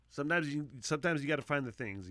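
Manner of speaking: ordinary talk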